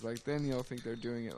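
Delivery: usual speaking voice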